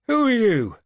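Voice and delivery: loud high shout across the yard; a sudden wary shout. A narrator's reading. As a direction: bellow the challenge from the doorway. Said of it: "he shouted".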